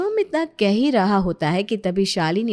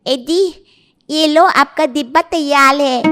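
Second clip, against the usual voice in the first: put-on high, loud childish lisp; lisping young wife offering help; 4 semitones higher, 6 dB louder than usual. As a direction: call out brightly with babyish consonants. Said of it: "अपनी तोतली बीवी को".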